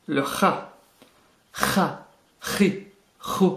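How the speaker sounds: "Ha, hi, hu" exhaled with a light scrape at the back of the throat; throat-scraped H. Shown as a demonstration. Teacher contrasting a similar letter, throat-scraped H. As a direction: scrape the throat a little on each H of "Ha, hi, hu". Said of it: "vous raclez un peu le fond de la gorge".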